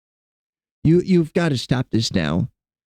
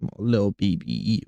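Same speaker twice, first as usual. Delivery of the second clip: softer, lower coo to the cat; tender cooing; 7 semitones lower, 5 dB quieter than usual. Real, not acted. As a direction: melt over the cat quietly.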